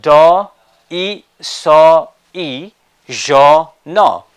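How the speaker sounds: decision broken into loud separate syllables. Shown as a demonstration. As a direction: sound out "do, i, so, i, jo, no" one beat each. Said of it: "And the next one is".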